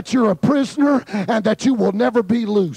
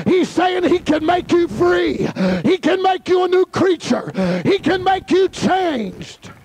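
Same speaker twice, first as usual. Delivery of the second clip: preaching at full force; 6 semitones higher than usual. Acted. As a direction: stretch every word like a proclamation. Preacher exhorting the room.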